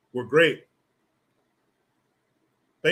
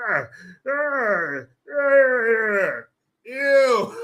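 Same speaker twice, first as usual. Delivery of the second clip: high mock moans ending in disgust; hammed-up moaning; 6 semitones higher, 3 dB louder, much slower than usual. Mocking sex noises.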